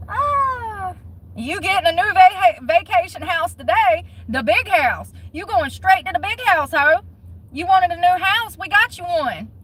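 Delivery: taunting a convicted official about her new house; gleeful taunting. Real, not acted. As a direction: rub it in with glee. Mocking taunt after laugh.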